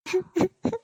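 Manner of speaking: short broken sobs; sobbing. Acted. Daughter breaks down before her mother, sobbing. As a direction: sob quietly in little bursts. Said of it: "ಎಷ್ಟಂತ ಅಳ್ತೀಯಾ".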